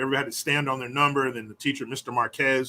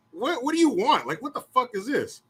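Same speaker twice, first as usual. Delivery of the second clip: incredulous; 9 semitones higher than usual. Retold or imitated. Confused confrontation in class.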